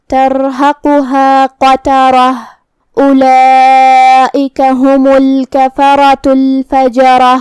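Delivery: slow melodic tajweed chant; Quran recitation. Shown as a demonstration. Teacher recites the verse in tajweed style. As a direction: draw the verse out in measured recitation.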